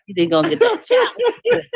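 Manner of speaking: high, fast, laughing through it. Real, not acted. Mocking her ex's hopes, laughing high. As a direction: giggle the words out high and quick.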